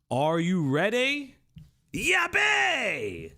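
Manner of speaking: high, sudden shouted catchphrase; Kamen Rider catchphrase. Retold or imitated. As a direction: yell it like a henshin call. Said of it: "that's a Kamen Rider Build reference".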